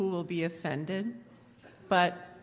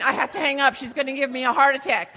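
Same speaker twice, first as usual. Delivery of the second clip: loud, high, hurried outburst; flustered mock dad; 6 semitones higher, 8 dB louder, faster than usual. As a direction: rush off the phone in agitation. Flustered dad hurriedly ends call.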